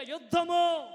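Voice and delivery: high loud shouted యుద్ధము. Real, not acted. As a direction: shout it out, stronger. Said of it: "గట్టి గట్టిగా".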